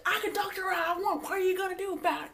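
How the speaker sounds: high, hammed-up challenge; mock defiance. Rachel or Angela squaring up, mock defiant. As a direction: imitate her high-pitched combative retort. Acting out an angry argument.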